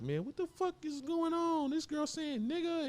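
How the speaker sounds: very high-pitched, incredulous imitation; mock outraged outsider. Rapper mimics the world reacting to [name], high-pitched disbelief. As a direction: squeal it like a scandalized onlooker.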